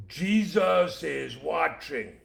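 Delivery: slow, loud, drawn-out eerie voice; each word stretched like a hidden voice; parrot voice. Acted. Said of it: "heard a voice say".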